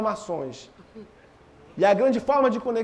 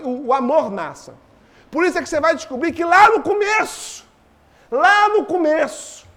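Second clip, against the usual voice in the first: raised, fervent preacher voice; preaching; 7 semitones higher, 4 dB louder than usual. Acted. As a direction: preach it with rising force. Preaching with rising force.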